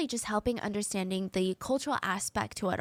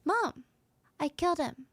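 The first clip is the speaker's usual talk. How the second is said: calmly; 6 semitones higher than usual. Retold or imitated. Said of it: "he just says so calmly".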